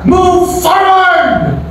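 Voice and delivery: high, slow shouted command; the Lord's command. Acted. The Lord commands Moses to move forward, shouting slowly. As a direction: push them onward. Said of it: "Sabi ni Lord kay Moses".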